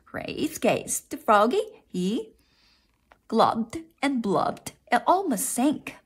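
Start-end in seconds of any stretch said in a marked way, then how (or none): none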